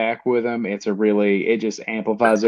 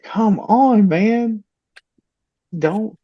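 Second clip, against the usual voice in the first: higher, pleading come on; exasperated plea; 9 semitones higher, much slower than usual. Retold or imitated. Dad pleading with his grounded son, exasperated. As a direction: plead with the kid, half exasperated. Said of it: "I was like".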